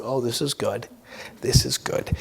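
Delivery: retold low, soft murmur to himself; hushed satisfaction. Seller eyeing his big check, hushed satisfaction.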